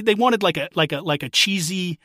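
ordinary talk

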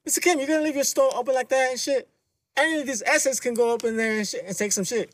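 mock warning. Voicing the man teasing Kim.

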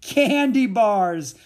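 loud, high, drawn-out shout; high triumphant holler; gleeful shout. A narrator's reading. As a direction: shout it slowly, bursting with glee. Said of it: "Mac shouted".

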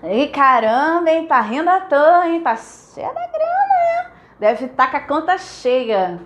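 envious friend teasing about her bank account. Retold or imitated. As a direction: needle her about the money with a mock grin. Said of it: "Essa era uma das piadinhas".

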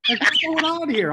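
high, loud incredulous cry; mock disbelief. To the host, about Italian beef, high mock outrage. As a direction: throw it up in exaggerated disbelief.

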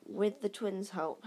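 ordinary delivery

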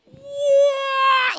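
high, loud imitated dive noise; loud high whoosh of a diving plane; mock dive whoosh. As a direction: sound out a plane falling like a kamikaze. Imitating the nosedive.

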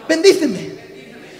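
loud, high, drawn-out plea; slow, ringing shout of supplication; prayer cry. Shown as a demonstration. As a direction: lead the congregation calling out to God. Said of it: "Levanta tu mano arriba y di conmigo".

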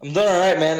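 echoing in an empty basement; talking in a bare echoey room. Real, not acted. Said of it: "It's really echoey in here".

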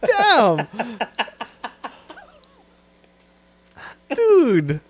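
loud, high-pitched mock offence; shouted playful protest, laughing; laughing indignation. Real, not acted. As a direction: exclaim in disbelief while cracking up. Mock-offended at friend's joke, laughing.

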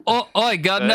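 high, mock British call; British accent. Podcaster keeps up the accent game, mock British accent. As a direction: throw out a hammed-up British greeting. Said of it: "I don't know how to do British".

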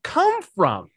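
high-pitched, incredulous question broken by a pause; retold astonishment. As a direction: act out baffled amazement. Repeats retold astonished reaction.

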